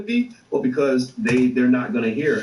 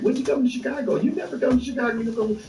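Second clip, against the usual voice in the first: much higher, whiny mock voice of nagging fans; mocking Chicago fans. Imitating Chicago fans begging him to visit, high mock whine. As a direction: squeak it like fans pestering him to come back. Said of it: "'Cause people been like".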